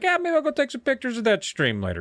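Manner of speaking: high, chirpy dad voice; breezy and pleased with himself; cheerful dad. Acted. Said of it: "he's like".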